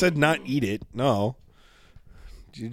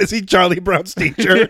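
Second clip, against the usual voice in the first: laughing; 7 semitones higher, 6 dB louder than usual. Real, not acted. Laughing at the lawyer bit.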